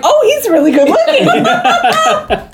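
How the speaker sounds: high, slow, drawn-out admiration; mock swooning. Wife first seeing the handsome boyfriend, mock swooning. Voicing Emily seeing Jacob.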